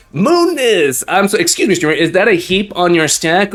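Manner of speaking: usual speaking voice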